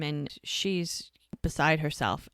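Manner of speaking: normal talking voice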